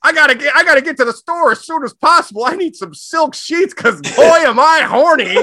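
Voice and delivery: high, loud, over-the-top excitement; frantic eager husband. Acted. Husband must get silk sheets now, hammed-up frantic. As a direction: blurt it out in a hammed-up high panic.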